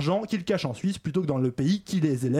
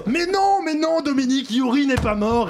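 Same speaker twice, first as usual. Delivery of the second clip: dramatic exclaiming; 6 semitones higher, 4 dB louder than usual. Acted. Dramatic soap-opera correction.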